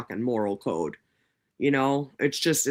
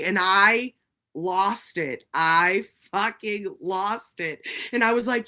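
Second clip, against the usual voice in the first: normal talking voice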